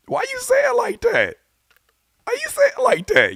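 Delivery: very high-pitched disbelief; incredulous squeal. Real, not acted. Reactor marvelling at a lyric's delivery, incredulous high squeal.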